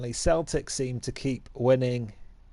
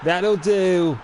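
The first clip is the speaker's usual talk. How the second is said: triumphant yell; 7 semitones higher, much slower than usual. Real, not acted. Game manager cheering as his team takes the lead. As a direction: erupt as the goal goes in.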